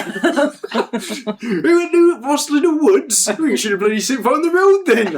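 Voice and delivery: loud, high mock Cornish accent; hammed-up Cornish accent. Co-host riffing on being from Cornwall, hammed-up Cornish accent. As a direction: wail it in a badly faked Cornish accent. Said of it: "I don't know what that accent".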